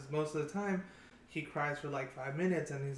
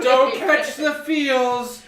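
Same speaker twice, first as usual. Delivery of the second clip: very loud, high, drawn-out holler; slow, hammed-up yell of comic alarm; mock warning shout; 10 semitones higher, 20 dB louder, much slower than usual. Joking warning, mimicking alarmed boys.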